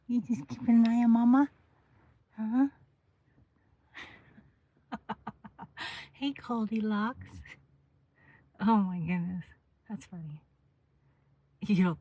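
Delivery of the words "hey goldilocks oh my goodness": usual speaking voice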